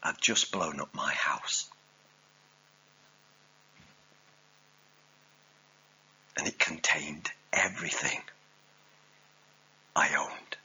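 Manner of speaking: low, hushed, with long pauses; soft, grieving confession, understated; quietly heartbroken. Acted. Gunner telling his general of his loss, hushed sorrow.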